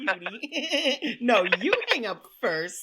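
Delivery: slow, high, giggly lovestruck; giggly late night call. Acted. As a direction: giggle and tease like a crush. Lovers' hang-up game, slow high.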